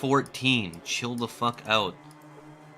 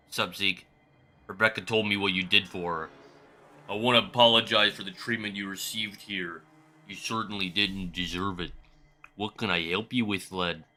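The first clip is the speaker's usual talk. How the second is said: put-on Canadian accent; 4 semitones lower, 5 dB quieter than usual. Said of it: "I don't know why he's Canadian".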